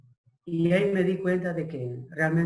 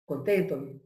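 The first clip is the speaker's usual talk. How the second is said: ordinary talk, nothing marked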